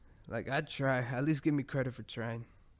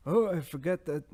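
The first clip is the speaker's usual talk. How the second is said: put-on accidentally Italian-sounding old man voice, higher and louder; Bernie Sanders impression; 4 semitones higher, 4 dB louder than usual. Podcaster mocks Bernie Sanders forgetting to send the invite, in an accidental Italian accent. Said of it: "I don't know why Bernie Sanders sounded Italian".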